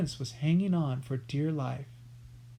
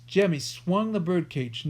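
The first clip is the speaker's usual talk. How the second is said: ordinary delivery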